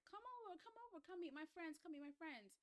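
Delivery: high, excited come over call; eager invitation. Retold or imitated. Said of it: "is like".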